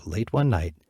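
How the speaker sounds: ordinary delivery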